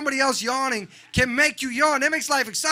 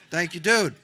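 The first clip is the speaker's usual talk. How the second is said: usual speaking voice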